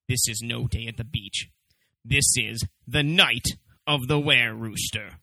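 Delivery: low, dramatic trailer narration; deep movie trailer announcer; horror trailer voice. Acted.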